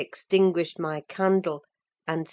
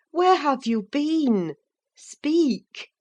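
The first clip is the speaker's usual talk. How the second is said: higher, sharp insistent demand; 9 semitones higher, 4 dB louder, slower than usual. A narrator's reading. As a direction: press her for the answer. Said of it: "I demanded".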